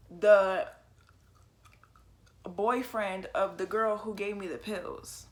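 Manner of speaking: usual speaking voice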